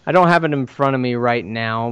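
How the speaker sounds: normal talking voice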